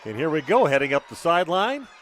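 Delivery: rising to a high, loud shout; excited touchdown call. Real, not acted. Announcer calls Waupun's run to the end zone, excited play-by-play. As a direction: build the live call to a big climax.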